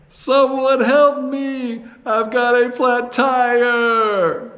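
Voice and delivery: high, slow, hammed-up plea for help; mock helpless cry for help. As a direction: wail it like a stranded damsel. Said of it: "it's kinda like this".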